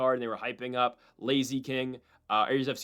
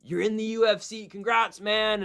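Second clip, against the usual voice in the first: upbeat, higher mock manager voice; cheerful manager; 8 semitones higher, slower than usual. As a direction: imitate the manager's chirpy congratulations. Manager congratulating McKee on video.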